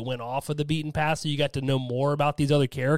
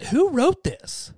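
high, slow disbelief; baffled who-wrote-this; incredulous; 12 semitones higher, 3 dB louder, slower than usual. Retold or imitated. Baffled reader quoting herself.

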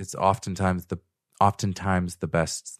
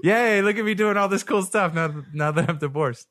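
higher, louder fake cheer with a laugh; mock celebrating; 11 semitones higher, 3 dB louder than usual. Joking ex-husband mocks himself to his ex-wife, with laughing fake glee. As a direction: gush sarcastically about the fun of divorce. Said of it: "come across like I was celebrating".

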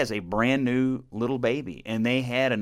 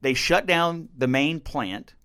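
ordinary talk

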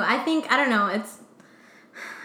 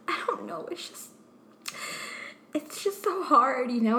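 mock sad; 3 semitones higher, 4 dB quieter than usual. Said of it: "she just looked so sad".

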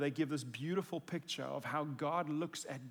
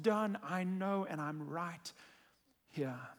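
higher, slow and tender, like holding someone close; Jesus comforting the narrator, tender and slow; tender reassurance; 7 semitones higher, 5 dB louder, slower than usual. Pretend. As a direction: reassure a frightened friend gently. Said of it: "I hear him say".

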